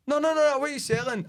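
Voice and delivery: a shopkeeper scrambling to keep him; pleading shopkeeper. Retold or imitated. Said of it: "shopkeeper pleading".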